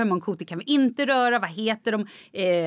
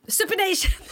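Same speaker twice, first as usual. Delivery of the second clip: very high, proud blurt; eager blurted answer; 10 semitones higher than usual. Retold or imitated. Malin answering the yoga quiz first, eager high blurt. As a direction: shoot the answer out instantly. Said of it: "jag bara".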